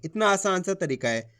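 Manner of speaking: ordinary delivery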